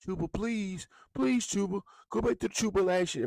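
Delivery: high whiny pleading; hammed-up high begging plea; mock begging fans. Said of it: "y'all back begging Chuba".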